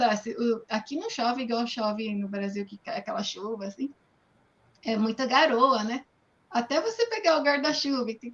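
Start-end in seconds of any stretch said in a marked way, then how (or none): none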